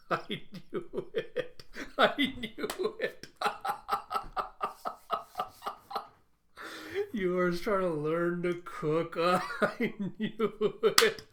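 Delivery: cracking up, words stretched by laughing; real laughter. Real, not acted. Host sees the cooking twist coming, laughing helplessly. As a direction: say it through a slow, high-pitched fit of laughter.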